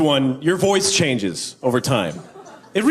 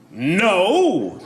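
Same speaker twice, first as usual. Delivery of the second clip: heroic superhero; 4 semitones higher than usual. Acted. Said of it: "impression of a superhero being propositioned".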